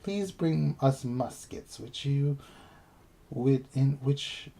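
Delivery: hammed-up British quest voice, giggling into it; British accent.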